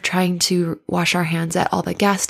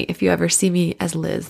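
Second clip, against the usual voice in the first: ordinary delivery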